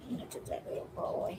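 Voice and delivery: whispered aside to the cat; barely voiced murmur to a pet; hushed. Real, not acted. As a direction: drop to a near-whisper, very soft. Talking softly to the rescued cat.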